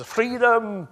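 put-on high, loud battle cry in a hammy Scottish accent; over-the-top Scottish war cry; dodgy Scottish accent. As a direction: roar it like a Scottish rebel on the battlefield. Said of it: "a really dodgy Scottish accent".

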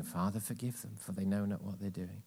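soft morning prayer. Shown as a demonstration. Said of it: "Verbalize forgiveness".

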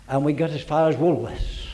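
ordinary talk

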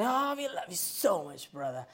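high-pitched mock-loving gush; hammed-up affection, swooping up high then dropping; gushing greeting. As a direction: imitate an over-affectionate churchgoer. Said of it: "So we go next Sunday".

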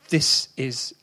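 ordinary talk

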